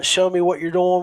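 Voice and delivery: ordinary talk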